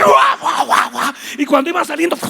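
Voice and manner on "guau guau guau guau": excited barking. Acted. Excited creature calls out.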